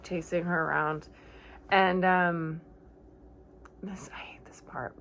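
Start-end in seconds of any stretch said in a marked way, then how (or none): 3.8-4.9 dreading whisper; hushed dread. Real, not acted. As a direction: whisper it as if you can't bear the scene. Dreading the upsetting scene.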